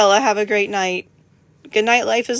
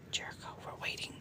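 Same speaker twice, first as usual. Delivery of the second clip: soft unvoiced whisper; 22 dB quieter, faster than usual. Real, not acted. Owner whispering to her dog while waiting. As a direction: breathe it to the dog.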